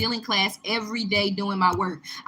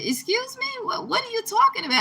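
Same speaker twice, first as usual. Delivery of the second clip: shocked disbelief. Retold or imitated. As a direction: pitch leaps high in stunned disbelief. Student shocked by police threat.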